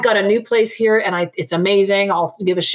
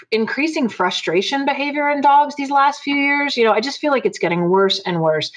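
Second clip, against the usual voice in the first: ordinary talk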